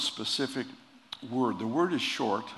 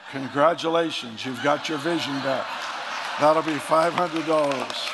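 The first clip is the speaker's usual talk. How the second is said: louder, higher, slowing on the price; gleeful punchline; 4 semitones higher, 8 dB louder than usual. Acted. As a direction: crow the bill out, slowing on it. Doctor Geezer's final punchline.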